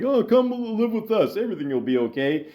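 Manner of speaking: high, mock-wholesome sitcom voice; nineties sitcom parent. Mock sitcom parents taking in a kid, hammed-up and sweet. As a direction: start high and gushy, then rattle off the reassurance. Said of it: "something you'd see in a fucking nineties sitcom".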